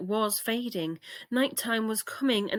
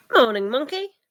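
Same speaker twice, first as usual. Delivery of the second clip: calling out; 4 semitones higher, 9 dB louder than usual. Acted. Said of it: "they called".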